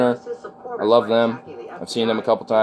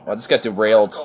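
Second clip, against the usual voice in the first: normal talking voice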